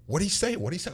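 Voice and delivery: high, startled double question; shocked. Retold or imitated. Coach shocked, asking the umpire what his son said, high and startled. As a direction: act stunned by the umpire's words.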